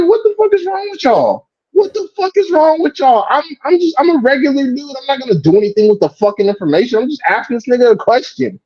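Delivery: high, incredulous outburst; exasperated retold yell. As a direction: demand to know what's wrong with them. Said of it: "I'm like".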